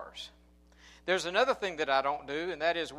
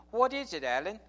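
imitating Dion; 5 semitones higher, 3 dB louder than usual. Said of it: "I love to hear him talk".

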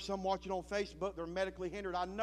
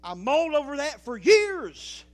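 preached loud; 11 semitones higher, 18 dB louder than usual. Real, not acted. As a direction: belt out the word years, much louder. Repeated line, now shouted.

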